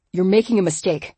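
growling warning; low growl. A narrator's reading. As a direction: growl the words low and hard. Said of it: "in a low growl".

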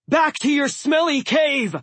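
high, loud angry yell. A narrator's reading. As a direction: drive the beast away. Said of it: "Blitzen yelled".

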